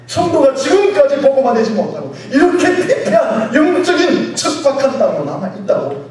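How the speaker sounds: loud, high, impassioned sermon cry; full-force preaching. Real, not acted. Preacher lamenting the town's unreached state, full-force preaching. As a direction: press the charge on the congregation at near-shout.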